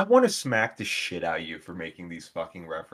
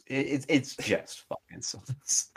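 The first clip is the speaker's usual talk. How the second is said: higher, drawn-out, soft, about to laugh; breaking into laughter; 6 semitones higher, 5 dB quieter, slower than usual. Real, not acted. Repeating the dig at Italian food to co-host, cracking up. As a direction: stammer it out as laughter takes over.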